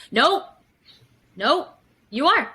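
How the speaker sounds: dismissive partner refusing to believe the other is calm; mock insistence. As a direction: shut down the other's denial, insisting.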